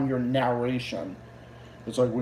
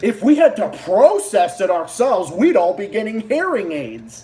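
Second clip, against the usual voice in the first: higher, louder parody of a narrator; mocking echo; 5 semitones higher, 4 dB louder than usual. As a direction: throw the narrator's line back mockingly. Mockingly echoes the clip's narrator.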